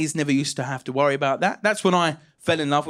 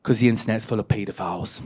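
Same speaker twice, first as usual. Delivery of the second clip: low-pitched motherly warning; imitating a low-voiced Essex mum; 7 semitones lower than usual. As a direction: explain it as mum, voice dropped. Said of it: "And she said".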